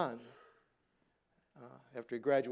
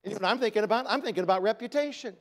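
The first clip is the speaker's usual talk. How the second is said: emphatic; 7 semitones higher, 8 dB louder, faster than usual. Real, not acted. Preacher's pointed question.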